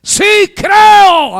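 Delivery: very high, very loud drawn-out cry; all-out acted yell; demonstrated full shout. Shown as a demonstration. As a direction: belt out the father's belief at the top of the voice. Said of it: "así".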